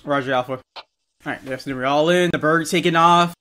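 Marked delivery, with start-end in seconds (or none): none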